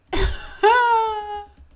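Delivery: loud, high-pitched burst of laughter; high squealing laugh. Real, not acted. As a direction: crack up in a long high squeal. Cracks up at sports joke.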